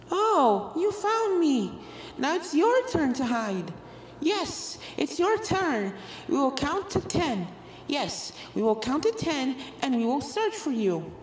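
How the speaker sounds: sweet but unsettling, echoing each other; eerie ghost twins. A narrator's reading. Ghost twins telling Apple Bloom to hide, high and eerie.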